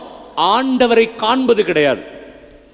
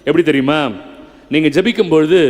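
usual speaking voice

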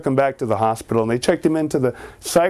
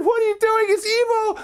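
put-on very high alarmed child; frantic kid. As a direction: warn the dad in a high panic. Boy warns dad frantically.

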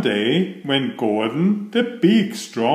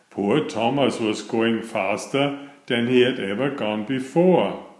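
usual speaking voice

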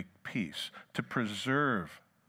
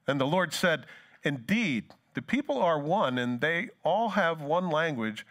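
preaching scripture; 4 semitones higher than usual. Acted. Preacher reading Babel story, raised and declaiming. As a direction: proclaim the Lord's words. Reads Genesis Babel passage.

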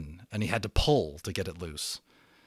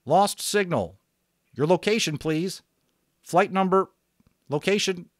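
higher, louder clipped radio procedure voice; radio operator; 8 semitones higher, 4 dB louder than usual. Acted. Radio operator telling an unknown caller to release the switch, brisk. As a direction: sound like a pilot on the net.

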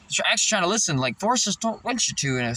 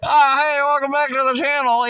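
bright raised vlogger greeting, louder and higher; chirpy host welcome; youtuber intro voice; 8 semitones higher, 5 dB louder than usual. Retold or imitated. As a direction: pretend to start a channel intro. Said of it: "I was all like".

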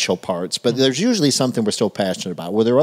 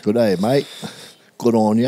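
Australian accent. Shown as a demonstration. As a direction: echo it in put-on Australian. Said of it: "Australian's all right".

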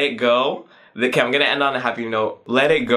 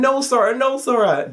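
mock yell; 11 semitones higher than usual. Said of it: "Everyone was screaming".

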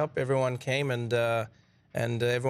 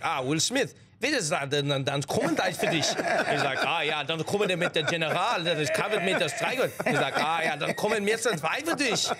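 lightly faked German dub voice, with pretend German words; mock German dub. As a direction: play a stiff German dubbing actor. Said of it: "they look at each other and like".

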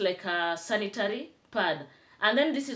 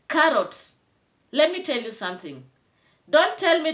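angry call-out; 6 semitones higher, 4 dB louder than usual. Real, not acted. Calling out rival again.

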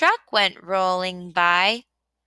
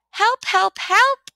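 high, loud cries climbing higher; crying for help; 11 semitones higher, 4 dB louder than usual. A narrator's reading. Little Blue, stuck in the mud, cries out for help. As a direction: call out for help, rising each time. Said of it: "cried the little blue truck".

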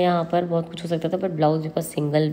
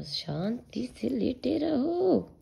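whispered soothing; 6 semitones higher, 7 dB quieter, much slower than usual. Real, not acted. Soothing toddler. (फुसफुसाकर बच्चे को सुलाते हुए)

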